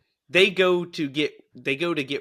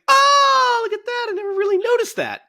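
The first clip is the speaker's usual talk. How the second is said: much higher, fast amazed gush; mock amazement; 5 dB louder than usual. Retelling noticing the foreshadowing, high mock amazement. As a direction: act stunned at a discovery.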